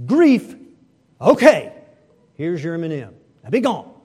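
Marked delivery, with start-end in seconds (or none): 1.2-3.9 mock-annoyed kid giving up one candy; grudging annoyance. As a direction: shove the candy over, fed up. Grudging child hands over candy.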